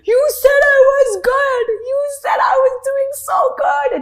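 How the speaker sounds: high, loud sobbing accusation; tearful call to the Hindi teacher; crying on the phone. Retold or imitated. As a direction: wail at the teacher through tears. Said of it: "I call my Hindi teacher".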